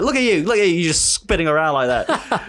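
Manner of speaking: taunting teacher with a camera; mock scolding teacher. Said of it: "She's like".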